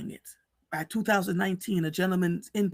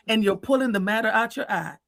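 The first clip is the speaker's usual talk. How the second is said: usual speaking voice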